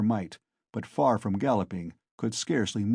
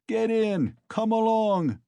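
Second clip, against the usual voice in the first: laughing crowd; 11 semitones higher, 4 dB louder, slower than usual. Acted. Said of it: "the crowd laughed".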